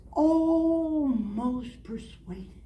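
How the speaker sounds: very slow, stretched words; drawn out. Real, not acted. Confessing he nearly converted, very slow and drawn out. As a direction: hold each syllable as a hymn would.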